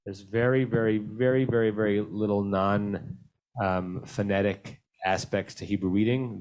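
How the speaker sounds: usual speaking voice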